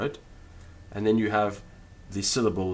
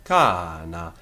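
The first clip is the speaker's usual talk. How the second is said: "cana" with long first a, pitch falling away; circumflex accent; 4 semitones lower, 4 dB louder than usual. Shown as a demonstration. Teacher repeating the example, "cana" with circumflex accent. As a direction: stretch "cana" on the first vowel, then drop. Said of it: "has got the circumflex accent".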